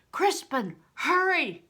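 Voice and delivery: loud, high call; loud urgent shout; urgent cry. A narrator's reading. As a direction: cry out to hurry him. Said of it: "cried Bear".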